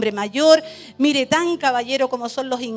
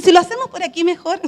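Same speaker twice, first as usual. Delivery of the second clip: eager suggestion; 7 semitones higher, 5 dB louder, faster than usual. Retold or imitated. Eager retold suggestion to soldier.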